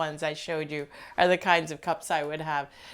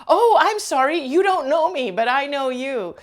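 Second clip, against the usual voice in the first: much higher, louder chirpy apology, imitating the stranger; imitating a cheerful confession; 8 semitones higher, 8 dB louder than usual. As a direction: cheerfully admit it like a close friend. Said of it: "the way she talked to me was as if we were intimate friends".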